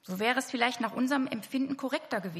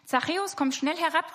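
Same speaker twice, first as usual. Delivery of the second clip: higher, louder call up into the tree; 6 semitones higher, 4 dB louder than usual. Acted. Jesus calling Zachäus down from the tree, raised call. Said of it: "rief Jesus".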